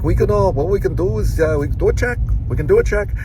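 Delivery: a hammed-up Irish door-to-door pitch; Irish accent. Doc salesman pitching a customer, in imitated Irish accent. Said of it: "he would go into an Irish accent".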